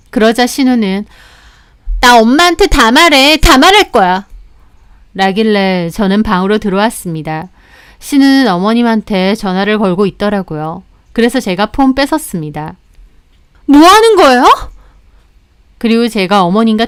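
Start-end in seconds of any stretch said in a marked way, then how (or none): 2.0-4.3 raised, higher-pitched tattletale whine; petulant threat. Acted. Sister threatening to tell their mother, petulant. 13.7-14.4 loud, high-pitched cry of outrage; outraged protest. Acted. Sister-in-law as her phone is snatched, outraged.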